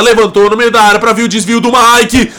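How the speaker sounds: fast build-up to a shout; explosive goal commentary. Real, not acted. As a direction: race through then yell. Live goal play-by-play.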